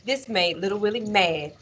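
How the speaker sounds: ordinary delivery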